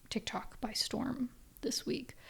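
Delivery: hushed, slowed whisper; soft whisper. Real, not acted. Whispering the news to her viewers. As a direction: drop to a slow confiding whisper.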